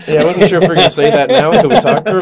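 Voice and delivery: high, fast, laughing through the words; flustered laughing. Real, not acted. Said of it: "Cut, cut".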